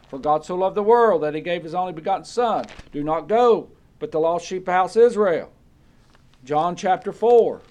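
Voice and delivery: loud, driving preacher's recitation; emphatic preaching. Acted. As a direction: press the verses on the congregation. Preaching, reciting verses forcefully.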